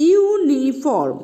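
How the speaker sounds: ইউনিফর্ম said slowly with a clear ইউ start; slow model word. Shown as a demonstration. Teacher modeling ইউনিফর্ম slowly for students. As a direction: stretch ইউনিফর্ম, yoo first. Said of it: "এখানে কি উচ্চারণ হলো".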